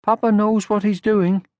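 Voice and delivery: high, gentle motherly voice; kindly mother troll; Moomin Mama. Acted. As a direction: soothe him like a calm mother.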